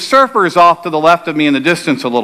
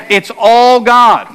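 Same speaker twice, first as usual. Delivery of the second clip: slow, higher, louder pulpit emphasis; preaching; 6 semitones higher, 4 dB louder, much slower than usual. Acted. Pastor telling the church it is all God, preaching. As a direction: drive home that it is all God.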